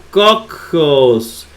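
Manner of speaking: cocos broken by a held middle c; wrong doubled consonant. Shown as a demonstration. Teacher listing how not to pronounce, cocos with doubled c. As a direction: lean long on the middle of cocos. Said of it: "no se pronuncia".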